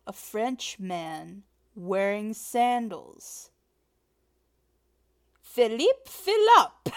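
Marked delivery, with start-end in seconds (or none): 5.4-6.9 high, very loud joke payoff; gleeful punchline. Acted. Telling a partner a pun, gleeful and loud. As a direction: belt out the silly punchline. Delivers own joke's punchline.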